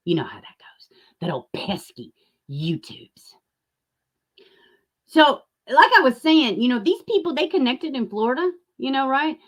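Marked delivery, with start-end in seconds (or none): 0.1-3.3 hushed, sly gossip aside; voiceless gossip whisper behind a hand; gossipy whisper. Real, not acted. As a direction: whisper it like passing on a rumour.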